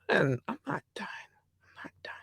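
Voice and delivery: hushed, fading whisper; playful whispered repeat; whispered aside. Real, not acted. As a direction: whisper it, trailing to almost nothing. Whispered aside to herself while listing.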